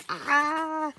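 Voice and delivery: high squeal of excitement; giddy excited squeal. Real, not acted. Fan made giddy by the gift, excited squeal. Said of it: "giddy and excited".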